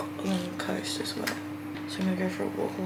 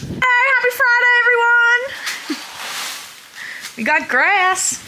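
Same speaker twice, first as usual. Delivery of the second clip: excited; 10 dB louder than usual. Real, not acted. Bright greeting to camera.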